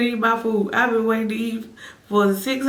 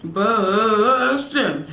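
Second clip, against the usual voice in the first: louder and laughing bussin'; 3 dB louder than usual. Real, not acted.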